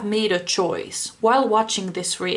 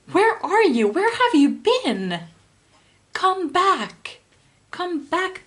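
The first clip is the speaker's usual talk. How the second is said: pleading call; 4 semitones higher than usual. Real, not acted. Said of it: "God, I'm getting angry".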